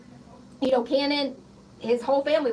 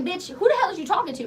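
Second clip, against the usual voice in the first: raised, fast, heated retold challenge; angry retort; 6 semitones higher, faster than usual. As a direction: fire it back fast and pitched up, reliving the fury. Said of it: "I was so motherfucking pissed".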